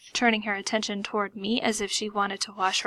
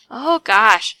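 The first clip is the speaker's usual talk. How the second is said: louder, strained exclamation; 10 dB louder than usual. A narrator's reading. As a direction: push it through pain. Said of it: "she said in a strained voice".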